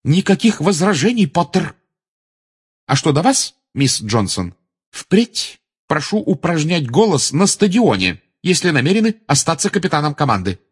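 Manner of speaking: high, emphatic teacher's order; stern reprimand. A narrator's reading. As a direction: shut down all argument firmly. Professor silences and scolds pupils. (строгий, категоричный выговор)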